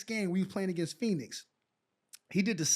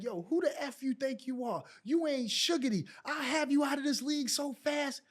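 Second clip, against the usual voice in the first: mock cursing out; 7 semitones higher than usual. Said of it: "He cursed me out".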